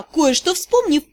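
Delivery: usual speaking voice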